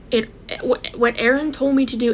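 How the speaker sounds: ordinary talk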